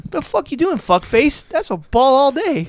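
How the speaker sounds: high, loud comic protest; mock umpire dispute. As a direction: act out a batter cursing a bad call. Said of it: "he goes to the umpire".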